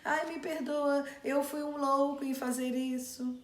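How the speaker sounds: high, whiny fake apology, pitched well up; mock groveling plea. Preacher mocking Simei's false plea to the king, high and whiny. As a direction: ham up a false man begging the king's pardon. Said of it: "amaldiçoava o rei e de repente".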